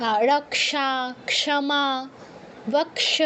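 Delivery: রক্ষা, ক্ষমা, বক্ষ with k then sh both sounded; k-sh conjunct. Shown as a demonstration. Teacher shows students Sanskrit conjuncts, ক্ষ spoken as k-sh. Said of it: "ক্ষিয়য়ের উচ্চারণটা হবে ক্ষ".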